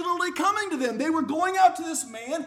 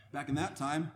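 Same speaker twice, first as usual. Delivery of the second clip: ordinary delivery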